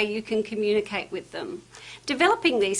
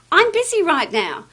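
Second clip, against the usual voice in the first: brusque brush-off; 6 semitones higher, 5 dB louder than usual. Shown as a demonstration. Brushing off customer when pushed.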